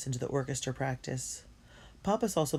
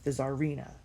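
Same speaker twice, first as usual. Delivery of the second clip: ordinary delivery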